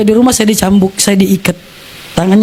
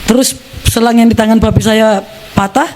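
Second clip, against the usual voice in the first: ordinary talk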